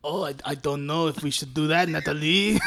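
put-on low, hesitant French-accented voice; mock French accent. Podcaster parodies Leon turning down Natalie, mock French accent. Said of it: "doing Russian accents while watching the".

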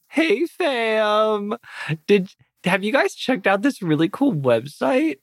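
chirpy influencer. Acted. Influencer tweets to followers about a website, chirpy. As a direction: greet your followers in a put-on lilt. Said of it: "and I say".

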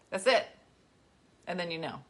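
ordinary delivery

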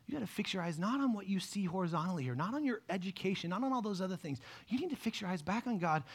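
ordinary talk, nothing marked

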